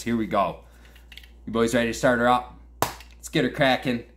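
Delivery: usual speaking voice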